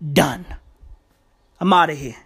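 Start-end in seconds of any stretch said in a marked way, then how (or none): none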